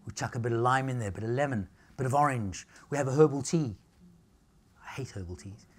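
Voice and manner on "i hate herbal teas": low unvoiced whisper; a confiding whisper to the crowd; whispered aside. Real, not acted. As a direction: let it slip out as a whisper. Aside confession to audience.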